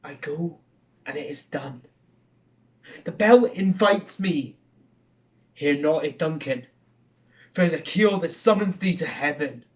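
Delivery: theatrical Macbeth soliloquy, Scottish-tinged; haunted murderer wrestling with a vision of a dagger; dramatic verse recitation, rising to bursts of force; Shakespeare monologue. Acted.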